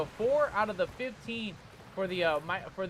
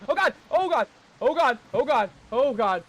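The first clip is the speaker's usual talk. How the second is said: loud repeated alarmed cries; 3 semitones higher, 7 dB louder than usual. Real, not acted. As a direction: cry out under attack. Being attacked, repeated cries.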